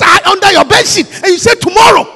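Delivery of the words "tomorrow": louder, higher lazy answer; mock Pharaoh. Pharaoh answers Moses about the frogs. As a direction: toss off tomorrow loudly.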